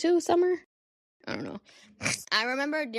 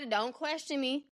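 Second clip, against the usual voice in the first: softer, slow mock warning; hushed mock-stern don't ask; playfully defensive; 4 semitones higher, 5 dB quieter than usual. Real, not acted. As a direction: warn them off, half-joking.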